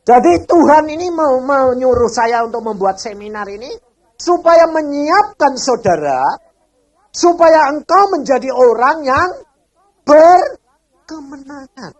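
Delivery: loud, slow sermon exhortation; booming pulpit voice, slow; 1 full-force preaching. Real, not acted. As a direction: drive the point home to the congregation.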